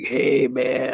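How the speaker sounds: loose, easy "Hey, man"; laid-back stoner guy. Retold or imitated. Narrator imitating the coworker's greeting, lightly voiced laid-back drawl. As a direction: toss it off like a mellow burnout. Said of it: "one of those, like".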